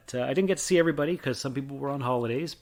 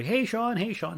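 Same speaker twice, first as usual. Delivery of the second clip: excited greeting; 10 semitones higher than usual. Retold or imitated. Friends greeting him like celebrity.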